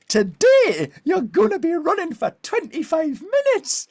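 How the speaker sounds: loud, high northern English announcement; Sarah Millican voice. Retold or imitated. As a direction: boom it out like a high-pitched northern coach. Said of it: "she talks like this".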